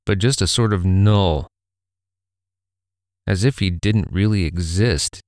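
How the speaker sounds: usual speaking voice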